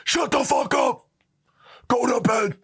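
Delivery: dad warning the boys through the tent, hammed-up. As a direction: play the dad hissing through the tent wall. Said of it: "Right through the tent".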